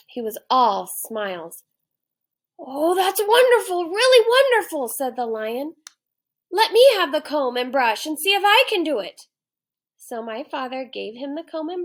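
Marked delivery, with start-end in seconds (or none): 2.6-4.9 much higher and louder gushing delight; delighted lion. Acted. Lion thrilled with his ribboned mane, loud high delight. 6.5-9.3 loud, higher eager request; eager to try it himself; eager lion. Acted. Lion asking for the comb, loud eager request.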